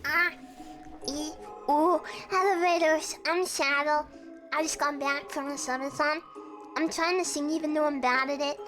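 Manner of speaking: ordinary talk, nothing marked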